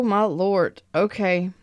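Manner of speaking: ordinary delivery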